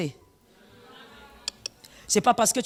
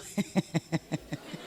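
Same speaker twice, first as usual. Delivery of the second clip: breathy mock giggle of the friends; imitated whispered giggle; 8 semitones lower, 11 dB quieter than usual. Pastor mimics the friends giggling when the husband arrives, hushed. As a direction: fake a hushed snicker. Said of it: "Elles se mettent à rire".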